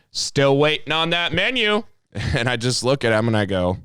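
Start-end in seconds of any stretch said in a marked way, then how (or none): 0.1-1.8 high, slow mock-impatient question; mock impatience, drawn out and high; pointed customer nagging. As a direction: imitate a customer pointedly waiting, pitched up.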